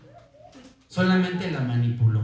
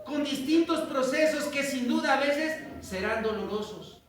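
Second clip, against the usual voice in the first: fervent preaching; 7 semitones higher than usual. Acted. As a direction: preach it to the congregation with fire. Preaching at full force.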